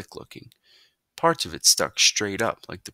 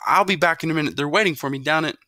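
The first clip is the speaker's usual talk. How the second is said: higher, faster pleading excuse; hurried teen excuse; 10 semitones higher, 7 dB louder, faster than usual. A narrator's reading. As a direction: rush the excuse out to get away. Son tries to escape.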